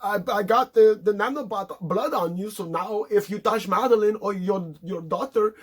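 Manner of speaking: high, taunting dying villain; a hammed-up high villain taunt; mock gloating villain. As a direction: gloat up at him from the floor. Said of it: "laying there on the floor like".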